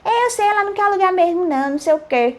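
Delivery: high, rushed mock ranting; crazy man imitation. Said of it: "gente louca é assim".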